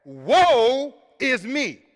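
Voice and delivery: slow, heavy woe is me; drawn-out lament. Shown as a demonstration. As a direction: drag it out like a lament. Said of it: "Think about that".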